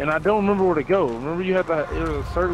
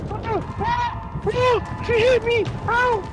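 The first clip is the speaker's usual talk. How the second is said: high panicked cries of pain; panicked yelp; much slower than usual. Real, not acted. Attacked in game, yelping.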